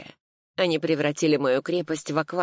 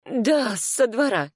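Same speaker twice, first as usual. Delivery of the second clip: much higher, tense answer; anxious reply; 7 semitones higher, slower than usual. A narrator's reading. Client confirms the back exit to lawyer, anxious. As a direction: answer eagerly, pitched well up.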